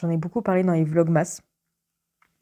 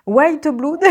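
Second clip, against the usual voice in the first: exaggerated English accent; 7 semitones higher, 4 dB louder than usual. Shown as a demonstration. Teasing the friend who mocks her English, exaggerated English accent. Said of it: "avec mon super accent".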